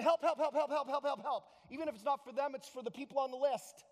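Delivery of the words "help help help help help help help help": rapid-fire cries for help; hammed-up, higher, repetitive begging; mock pleading. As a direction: rattle it off like an unthinking prayer. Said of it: "and then we go".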